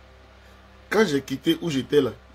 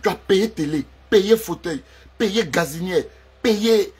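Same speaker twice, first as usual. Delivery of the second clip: raised voice, each payé punched; indignant listing; 6 semitones higher, 6 dB louder, slower than usual. Acted. Man reproaching ex, loud indignant listing. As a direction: hammer home every purchase. Angry list of what she paid.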